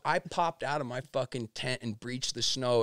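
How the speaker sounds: ordinary delivery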